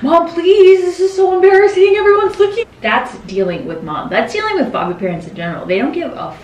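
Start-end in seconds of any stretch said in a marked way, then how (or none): 0.0-2.6 high, loud mock-panicked plea; anxious pleading. Anxious Ryan pleading with mom in line, mortified. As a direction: beg mom like a mortified kid. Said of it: "Ryan had more anxiety than me".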